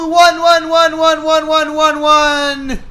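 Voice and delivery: high wailing scream of repeated words. Acted. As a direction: wail in high anguish, fading a little. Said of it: "Hannah fails to the ground and screams".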